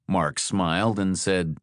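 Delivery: usual speaking voice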